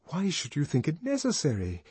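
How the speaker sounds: high, mildly irritated question; note of irritation. A narrator's reading. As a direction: ask it with restrained annoyance. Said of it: "a note of irritation in her voice".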